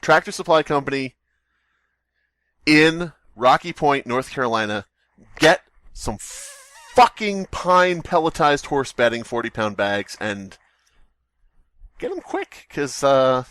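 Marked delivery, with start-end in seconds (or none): none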